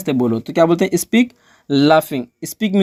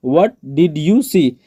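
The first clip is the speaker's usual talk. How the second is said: slow, evenly spaced words; slow teaching repeat; much slower than usual. Shown as a demonstration. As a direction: say each word separately for a learner. Teacher repeats phrase word by word.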